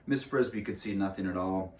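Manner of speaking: ordinary delivery, nothing marked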